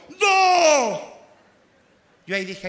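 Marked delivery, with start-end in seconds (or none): 0.1-1.0 very loud, high husband's bellow; крик мужа. Acted. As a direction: burst out with a booming count. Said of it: "mi marido gritó".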